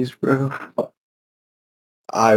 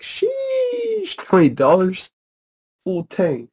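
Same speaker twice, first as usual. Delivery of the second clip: amazed; 8 semitones higher, slower than usual. Retold or imitated. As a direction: gasp at how cheap gas is. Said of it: "I was like".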